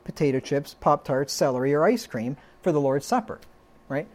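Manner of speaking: usual speaking voice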